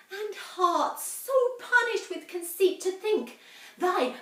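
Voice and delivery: high, impassioned plea to a tyrant; pleading young woman. Acted. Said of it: "a group of young women come to plead for mercy".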